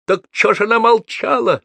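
high, loud outraged outburst; roaring. A narrator's reading. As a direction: roar the question in exasperation. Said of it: "взревел Гордей".

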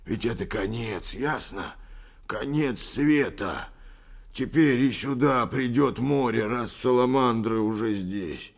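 agitated prophecy of disaster; mildly desperate. A narrator's reading. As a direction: warn of doom. Old man proclaims world's end.

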